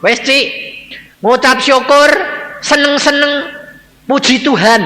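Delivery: high, loud, hearty urging; loud encouragement. Retold or imitated. Brother urging his sister to praise God, loudly encouraging.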